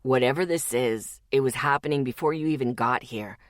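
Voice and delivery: low, level male voice; logical. Acted. Zeke calmly reasons with Lexi, low and level.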